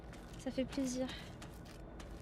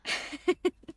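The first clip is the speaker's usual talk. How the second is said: breathless laugh; 6 semitones higher, 5 dB louder than usual. Real, not acted. Escapes monster, bursts out laughing.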